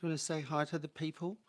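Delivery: high cooing voice for a pet, soft and sing-song. Real, not acted. Owner cooing high to her sleepy dog, pet baby talk.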